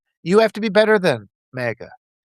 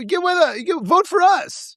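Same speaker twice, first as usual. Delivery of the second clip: mock party boss; 10 semitones higher than usual. Mocking party bosses demanding votes.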